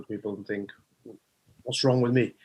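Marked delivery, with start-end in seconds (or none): none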